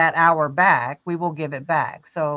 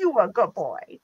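pet voice. Acted. Praising Antonio like a good dog, lightly put-on cooing. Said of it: "I do that to my husband".

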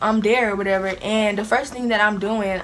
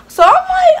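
sudden high loud burst; high exasperated squeal; fed-up high yelp; 12 dB louder than usual. Retold or imitated. Getting mad at questions.

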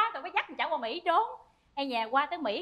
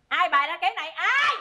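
angry demand; 6 dB louder, faster than usual. Acted. Wife discovering the fake death, angry demand.

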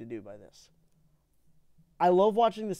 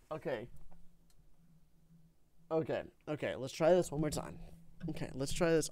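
ordinary delivery

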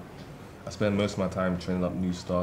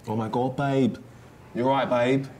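put-on Essex accent; 4 semitones higher than usual.

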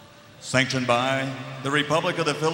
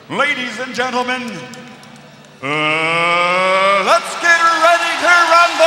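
ring announcer call; 9 semitones higher, 9 dB louder than usual. Acted. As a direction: stretch it out and blast the finish. Iconic rumble call.

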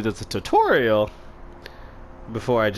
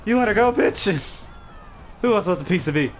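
battle taunt; 8 semitones higher than usual. Pretend. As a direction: yell out for the next opponent. Taunting enemies in game battle.

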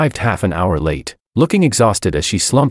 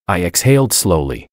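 ordinary delivery

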